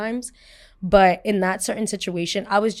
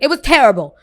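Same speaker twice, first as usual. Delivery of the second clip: loud, higher-pitched retold complaint; upset outburst; 6 semitones higher, 11 dB louder than usual. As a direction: burst out with it, upset. Retelling her upset answer to attendant.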